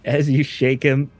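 normal talking voice

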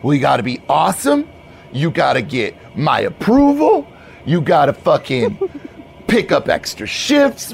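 rattled-off list, climbing in pitch; bro manager, emphatic. Acted. Manager lists probation demands, emphatic bro. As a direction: build the demands with bro insistence.

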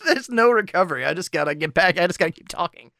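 breathless, high after laughing; recovering from giggles; after laughing hard. Real, not acted. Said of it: "unnecessary laughter".

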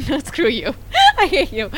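laughing, mock offended; laughing while telling her friend off; teased by her friend, she laughs back. Real, not acted.

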